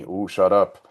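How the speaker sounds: lightly imitated lemur-king accent, hushing the crowd; a quick imitated King Julian accent telling someone off. Recalling a cartoon king, a hint of the King Julian accent. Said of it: "he says it with the accent".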